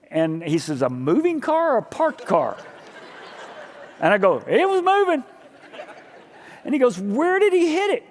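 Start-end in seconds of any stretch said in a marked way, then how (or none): 0.8-2.6 mock agent voice, higher; incredulous agent. 4.5-5.2 high, loud sheepish reply; mock innocent. His answer, mock-meek. 7.0-8.0 high mock agent voice; incredulous agent. Agent asks follow-up.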